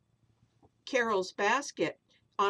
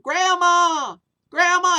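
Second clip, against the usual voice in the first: long high falling calls, much louder; calling out; 9 semitones higher, 15 dB louder, slower than usual. Acted. Kids calling for lost grandma, loud long calls. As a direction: shout her name down the street, searching.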